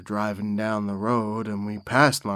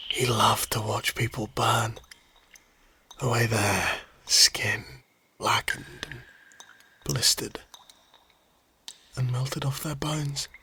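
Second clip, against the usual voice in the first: whispered menace. Acted. Telling how he loved to watch people burn, hushed whisper. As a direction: whisper it like a dark secret.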